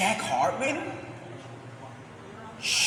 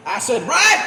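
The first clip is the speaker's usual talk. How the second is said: excited shout; 3 semitones higher, 8 dB louder than usual. Acted. Ranting viewer recognising the video, shouting. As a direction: yell Acid Rain in recognition.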